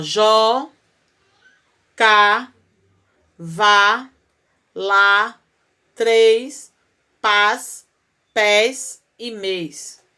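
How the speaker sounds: "jó", "cá", "vá", "lá", "três", "pás" each called out loud and separately, then "pés e mês" slowly; emphatic list. Shown as a demonstration. Teacher calls out the monosyllables loudly for the class. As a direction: punch each monosyllable on its own. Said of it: "Monossílaba é quando só tem uma sílaba".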